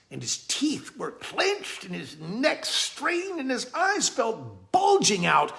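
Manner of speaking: high, drawn-out, straining narration; strained narration. A narrator's reading. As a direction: narrate as if holding a great weight. Straining to hold the log.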